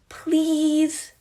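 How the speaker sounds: put-on high, loud pleading child; begging kid. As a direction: beg like an eager little boy. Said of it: "Remus begs".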